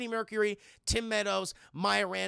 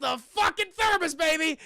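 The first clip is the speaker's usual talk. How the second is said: higher, louder excited call; hyped shout; 8 semitones higher, 7 dB louder than usual. Real, not acted. Telling chat about the thermos, hyped shout. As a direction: sell the big reveal.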